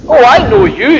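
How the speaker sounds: lightly acted accusing maidservant; blurted accusation. A narrator's reading. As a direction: blurt it out as you recognise someone. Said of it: "she blurts out".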